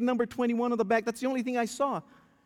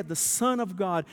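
normal talking voice

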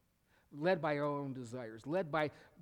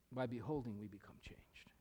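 whispered and much softer, lower; 4 semitones lower, 5 dB quieter than usual. Shown as a demonstration. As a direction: breathe the verse softly to let it sink in. Verse repeated softly.

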